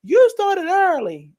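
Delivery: high, slow surprised viewer. Retold or imitated. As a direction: act shocked they started early. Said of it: "They gonna be like".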